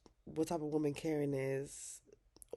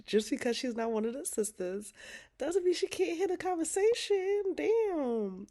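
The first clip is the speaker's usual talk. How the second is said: much higher and louder outburst; heated rant; 11 semitones higher, 4 dB louder than usual. Real, not acted. Vlogger defending Pam while recapping the episode, heated outburst. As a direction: defend Pam with rising heat.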